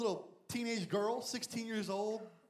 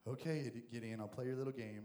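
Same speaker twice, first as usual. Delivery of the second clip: lower, softer amused God voice; low, indulgent God humoring Gideon; 8 semitones lower, 4 dB quieter than usual. Retold or imitated. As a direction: humor him like a patient parent.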